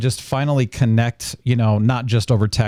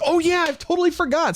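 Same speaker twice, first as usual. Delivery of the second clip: much higher pitched retold realization; sudden realization. Host reacting to the show notes, sudden realization. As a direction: act out remembering with a jolt. Acts out own sudden remembering.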